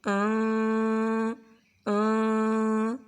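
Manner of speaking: long drawn-out آں with the N hidden; hidden nasal N. Shown as a demonstration. Teacher demonstrates the ikhfa sound for students. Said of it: "اس طرح اسے چھپائیں گے".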